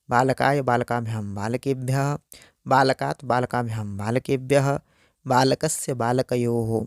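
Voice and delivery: the forms बालकः, बालकौ, बालकाः rattled off in a quick flowing chant; brisk run-through. Shown as a demonstration. Teacher running through the बालक forms again as a quick drill. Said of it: "बार बार अभ्यास करें".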